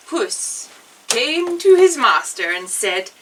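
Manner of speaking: usual speaking voice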